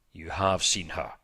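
low, forceful note of resentment; a touch of indignant accusation; resentful. A narrator's reading. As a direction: say it with restrained resentment. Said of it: "with an accent of resentment".

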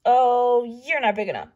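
loud dismissive scoff; grouchy ladybug. A narrator's reading. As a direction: scoff and fly off. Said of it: "said the grouchy ladybug".

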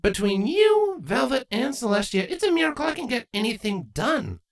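a fond eye-rolling complaint; young Twilight. A narrator's reading.

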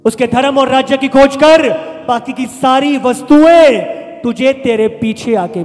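loud, forceful scripture proclamation; preaching scripture. Real, not acted. Preacher quoting a promise to the congregation, full-force preaching. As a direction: declare the verse loudly to the room.

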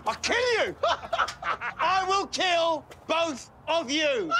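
a hammed-up villain's threat to the neighbours; mock menacing threat. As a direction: stretch out each word like a movie villain.

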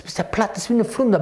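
usual speaking voice

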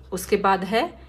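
usual speaking voice

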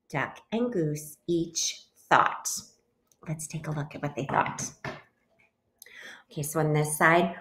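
ordinary delivery

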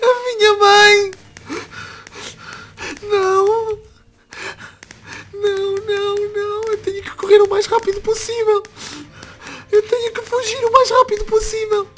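high, tearful panicked voice; frightened distress. Acted. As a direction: wail as you flee in fear. Fleeing, frightened for mother.

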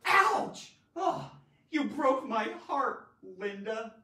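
sharp, loud, high yelp of hurt; pained drama. Shown as a demonstration. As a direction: melodramatic heartbroken outcry. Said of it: "Pain in terms of conveying an emotion".

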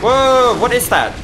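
high, loud burst of surprise; startled exclamation. Real, not acted. Gamer reacting to an unknown boss move, startled exclamation. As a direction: cry out, taken aback.

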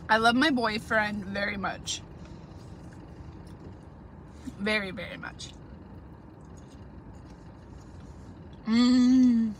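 speaking with food in mouth; words between bites of salad; talking while chewing. Real, not acted. Seen 4: takes a bite at -1, then speaks with a moving, food-filled mouth.

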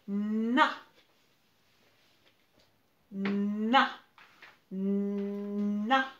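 "Na" with a long hummed nnn, then a loud a; sounding out syllable. Shown as a demonstration. Teacher asking for the second syllable, stretched first consonant. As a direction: hum the n before releasing "Na". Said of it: "Quelle est la deuxième syllabe".

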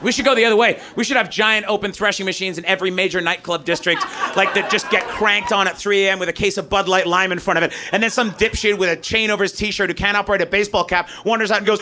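normal talking voice

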